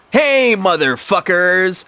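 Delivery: high, slow villainous greeting; acted evil brat. A narrator's reading. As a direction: drag out the greeting with menace. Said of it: "greeted in an evil voice".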